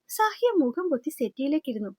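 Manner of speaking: usual speaking voice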